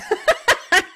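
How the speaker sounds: loud, high burst of laughter; amused laugh. Real, not acted. Reader amused by the appliances joke, high laughing burst.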